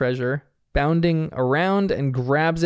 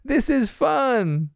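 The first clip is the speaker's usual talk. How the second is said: put-on urging kid; 9 semitones higher, much slower than usual. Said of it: "Bluey urges Missy".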